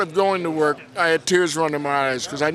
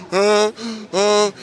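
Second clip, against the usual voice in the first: loud, high honking "uh, uh" laugh; Eddie Murphy laugh; 6 semitones higher, 5 dB louder than usual. Retold or imitated. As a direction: bray it out like Eddie's trademark laugh. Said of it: "he's got this very distinctive laugh".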